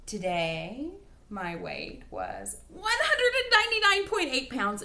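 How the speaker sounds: high, loud, thrilled reveal of the number; excited announcement. Real, not acted. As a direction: burst out with the big news. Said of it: "and I am so excited".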